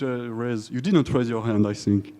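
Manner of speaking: ordinary delivery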